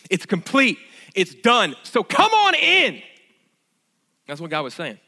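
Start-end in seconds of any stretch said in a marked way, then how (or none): 0.1-3.0 rising to a loud, high, slowed cry of welcome; God's call building. Acted. God inviting everyone in, building to a loud, high call. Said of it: "It was like God was just saying".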